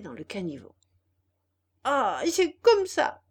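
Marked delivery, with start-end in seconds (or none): 1.8-3.2 loud, high furious puppet outburst; angry cry. Acted. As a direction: shout it out in rage. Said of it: "cria le pantin de plus en plus en colère".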